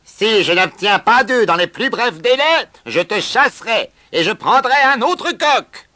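loud, high-pitched ultimatum; angry shouting. A narrator's reading. Farm owner threatens to replace the rooster, angry shouting. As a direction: threaten the sack at full volume. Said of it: "crier à Supercoq".

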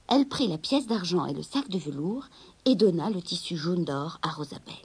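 plain reading voice

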